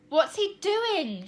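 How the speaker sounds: high, loud, slow impatient demand. A narrator's reading. Tina repeats her question impatiently. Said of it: "she repeated impatiently".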